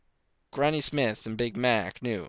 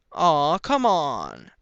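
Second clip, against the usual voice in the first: put-on high, drawn-out pleading little girl; pleading filly; 6 semitones higher, 8 dB louder, much slower than usual. A narrator's reading. Young Applejack begging her father. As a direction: coax Pa like an eager young filly. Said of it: "Applejack pressed".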